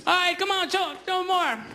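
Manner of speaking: high mock trainer urging the elephant; circus trainer. Comedian voicing the trainer at the elephant, high and mocking. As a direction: egg the elephant on like a ringmaster.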